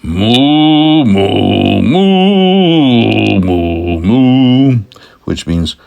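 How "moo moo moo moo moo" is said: chatty string of cow calls; cow mooing. Acted. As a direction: moo conversationally, long and loud. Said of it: "So the cow said".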